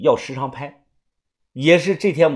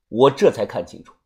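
usual speaking voice

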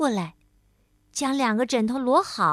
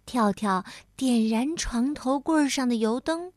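plain reading voice

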